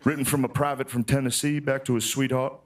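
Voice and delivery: mock-solemn documentary reading; hammed-up sentimental reading; dramatic letter reading. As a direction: read it like a tender Civil War letter. Reading a soldier's love letter.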